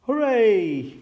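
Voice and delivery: loud, high, drawn-out cheer. A narrator's reading. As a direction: cheer long and joyfully. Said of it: "Everyone cheered".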